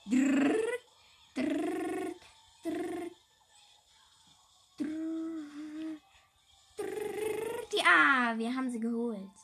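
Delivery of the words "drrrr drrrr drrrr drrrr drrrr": high rolled drrrr sound effect; rolled-r noise. Pretend. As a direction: buzz the r like a motor. Imitating engine or gun noise. (gerolltes Motorgeräusch)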